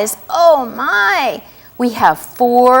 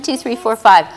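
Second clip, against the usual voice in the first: ordinary delivery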